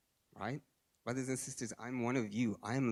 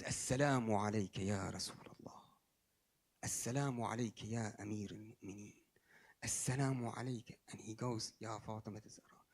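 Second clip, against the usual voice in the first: faint breathy greeting of a dying man; hushed farewell from a deathbed; dying whisper; 4 semitones lower, 5 dB quieter than usual. Retold or imitated. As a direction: whisper as if with your last strength. Dying man greets the holy figures.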